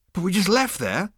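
high-pitched indignant protest. A narrator's reading. Zaphod objects to waiter's answer, protesting. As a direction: protest in disbelief. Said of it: "protested Zaphod".

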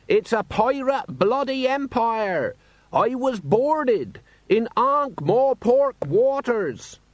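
high, slow indignant shout; shouting merchant. A narrator's reading. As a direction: shout your grievance across the square. Merchant keeps shouting grievance.

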